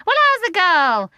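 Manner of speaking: high, loud cheeky bird; starling teasing Mary Poppins, high and cheeky. A narrator's reading. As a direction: ask it cheekily. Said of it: "he inquired cheekily".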